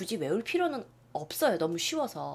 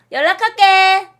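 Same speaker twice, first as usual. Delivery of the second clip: emphatic; 9 semitones higher, 14 dB louder than usual. Shown as a demonstration. Teacher stresses meaning.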